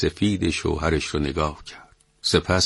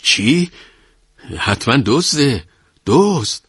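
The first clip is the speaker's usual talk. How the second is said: high, loud alarmed yelp; stunned, frightened cry; 8 semitones higher, 5 dB louder than usual. A narrator's reading. Wife learning of a thief on the roof, frightened high cry. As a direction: shriek it out in shock. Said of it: "ترس در نگاهش دوید و مات زده گفت".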